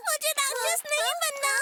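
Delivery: high, tearful protest; crying. Acted. Franny refuses to become a monster, crying. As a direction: wail it like an upset child. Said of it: "रोना बंद करो".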